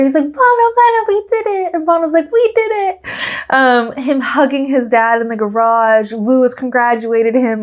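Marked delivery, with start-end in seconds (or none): 0.2-1.7 high-pitched mock cheer over the radio; imitated cheering. Toto cheering to Bono on the radio, high imitated cheer. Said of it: "just cheering for each other". 2.3-3.1 very high answering mock cheer; imitated cheering. Bono cheering back on the radio, high imitated cheer. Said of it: "just cheering for each other".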